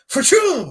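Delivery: loud, high mock "ba-choom"; imitated sound effect. Co-host replays the silly movie moment, imitated sound effect. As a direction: fire off a silly zooming noise. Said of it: "just the sound effect of the ba-choom".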